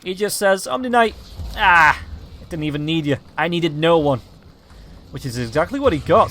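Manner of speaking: usual speaking voice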